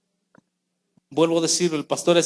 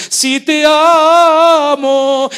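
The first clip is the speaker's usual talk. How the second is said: very slow, higher, louder drawn-out yes; a churchgoer overplaying love for God; exaggerated devotion; 9 semitones higher, 9 dB louder, much slower than usual. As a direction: stretch it out in hammed-up fervor. Believer's exaggerated reply.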